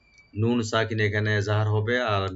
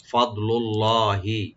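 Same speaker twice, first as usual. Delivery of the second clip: slow, drawn-out chanted word; slow recitation; much slower than usual. Shown as a demonstration. Teacher modelling the word for students. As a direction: intone it slowly as a model. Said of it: "ফা open থাকবে".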